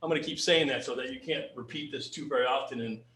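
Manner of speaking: ordinary talk, nothing marked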